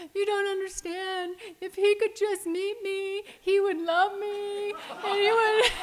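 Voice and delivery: put-on high, loud, swooning teen crush voice, laughing and tearful; teenage me, gushing. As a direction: gush like a lovesick thirteen-year-old. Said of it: "'Cause I'm like".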